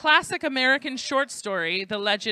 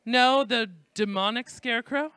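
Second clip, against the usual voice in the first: usual speaking voice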